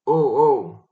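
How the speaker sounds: loud, anguished groaning cry; despairing roar. A narrator's reading. Kutuzov sees his troops fail, despairing roar. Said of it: "rugit Kutuzov avec l'accent du désespoir".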